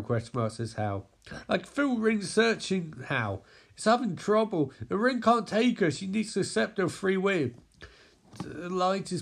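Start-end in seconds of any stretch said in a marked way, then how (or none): 1.5-7.5 high, urgent explanation; urgent worry. Acted. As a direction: report trouble anxiously, pitched up. Hal worries about Carol's struggle.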